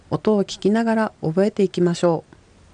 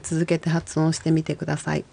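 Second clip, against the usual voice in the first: normal talking voice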